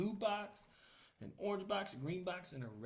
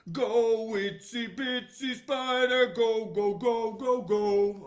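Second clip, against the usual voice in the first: louder cheering chant; friends shouting; 6 semitones higher, 14 dB louder, slower than usual. Acted. Friends cheer the spider up the spout, loud chant. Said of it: "Pete and his friends shouted".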